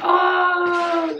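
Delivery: high delighted shout; surprised. Real, not acted. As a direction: gasp with joy at the gift. Unboxing a surprise gift.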